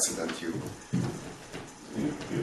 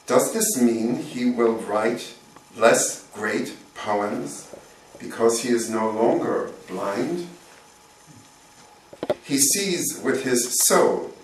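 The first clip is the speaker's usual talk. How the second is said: deliberate recital with lifted line openings; poetry reading; 5 semitones higher, 8 dB louder, slower than usual. Acted. Poet reads of a blind poet cured, poetry reading. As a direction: read it out to the room, spacing every phrase. Reads own poem aloud.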